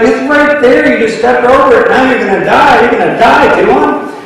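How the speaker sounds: high, insistent doom-saying taunt; mocking warning. Native guide warns Otto he'll die, high taunting voice. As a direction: tell him he's done for, half laughing.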